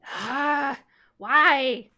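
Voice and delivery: put-on high, loud childish whine; whiny complaint. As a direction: whine at the failed spell like a kid. Child frustrated spell fails.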